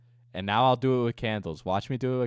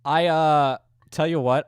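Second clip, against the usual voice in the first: loud declaration; 5 semitones higher, 9 dB louder than usual. Real, not acted. Announcing business challenge.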